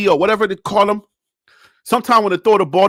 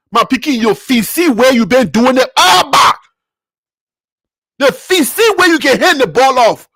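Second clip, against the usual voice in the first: Liberian English; 3 semitones higher than usual. Acted. Scolding the quarterback in Liberian English. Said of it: "I had to go to my Liberian English".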